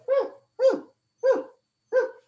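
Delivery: high hooting bark noises, repeated; imitated dogfish bark. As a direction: hoot like a barking fish. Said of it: "he could hear this noise back there going".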